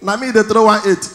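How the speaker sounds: usual speaking voice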